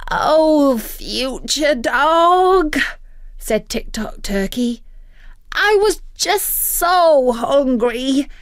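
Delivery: a bird wheedling out of trouble; whining turkey voice; pleading turkey. Acted.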